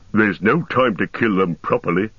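lightly voiced orc in a dispute; quarrelling orc. A narrator's reading. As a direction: grumble as an orc warrior.

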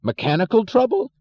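high, loud outraged yell. Acted. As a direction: yell it in disbelief. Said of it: "yelled Mister Thompson".

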